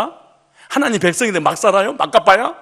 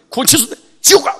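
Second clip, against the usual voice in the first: mock stern pastor threat, louder; threatening pastor; 9 semitones higher, 8 dB louder than usual. Preacher mimics pastor scaring members, mock stern threat. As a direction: bark it like a domineering pastor. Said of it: "막 이래버리면 되니까".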